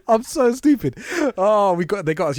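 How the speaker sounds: high, loud, laughing confession; laughing self-mockery. Real, not acted. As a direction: own up while cracking up. Laughing at himself for falling for it.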